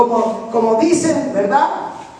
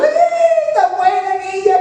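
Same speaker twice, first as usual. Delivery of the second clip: much higher mock wailing mourner; hammed-up lament, much higher; mourners' wail; 6 semitones higher than usual. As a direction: imitate a hired mourner crying out for the girl. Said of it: "ahí estaban llorando".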